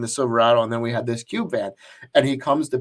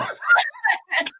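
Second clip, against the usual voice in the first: high squeal of laughter. Real, not acted. Laughing after punchline.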